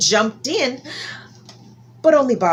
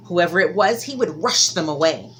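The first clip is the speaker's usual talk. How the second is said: ordinary delivery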